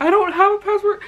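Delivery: high, loud retold panic; hammed-up panicky outburst; freaking out. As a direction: squeal it in mock panic. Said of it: "I started freaking out".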